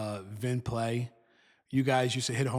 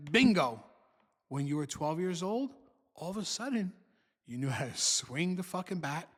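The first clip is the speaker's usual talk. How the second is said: ordinary talk, nothing marked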